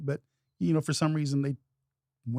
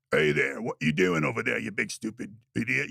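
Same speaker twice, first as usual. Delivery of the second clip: ogre voice; 4 semitones lower than usual. Retold or imitated. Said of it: "I want it to say something".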